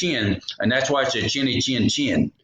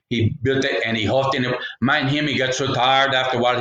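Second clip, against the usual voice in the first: usual speaking voice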